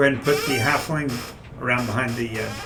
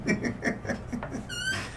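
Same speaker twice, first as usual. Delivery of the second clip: high cartoon laugh; 10 dB quieter than usual. Troll laughs after joke.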